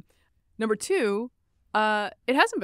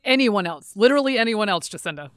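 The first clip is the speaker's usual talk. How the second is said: sarcastic; 2 semitones higher, 8 dB louder than usual. Real, not acted. Sarcastic retort to the clip.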